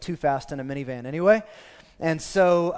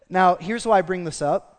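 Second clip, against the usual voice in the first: usual speaking voice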